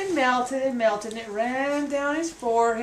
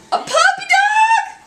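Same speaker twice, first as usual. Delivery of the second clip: very high, loud, slow "A puppy dog?"; mammy horrified at the dead puppy; shocked shriek; 8 dB louder, slower than usual. Acted. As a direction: stretch it out in disbelief.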